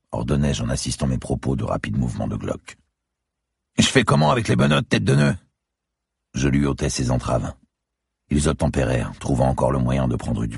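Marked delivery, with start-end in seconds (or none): 3.8-5.4 high, loud, exasperated; angry retort. A narrator's reading. Handcuffed man told to strip, angry retort. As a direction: throw the absurd order back at him.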